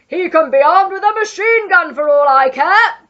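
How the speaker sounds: furious loud bellow; Duke bellowing. A narrator's reading. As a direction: roar it with bravado. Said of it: "bellowed the Duke".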